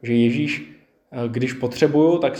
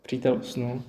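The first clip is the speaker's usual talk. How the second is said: softly, gently hinting at bad news; gentle voice; 2 semitones lower, 6 dB quieter, slower than usual. Jesus telling his disciples, gently and softly. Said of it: "Promlouvá takovým jemným hlasem".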